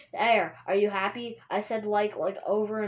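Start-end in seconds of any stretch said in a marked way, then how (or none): none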